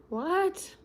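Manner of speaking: startled high what; surprised. Real, not acted. Reacting to a surprise.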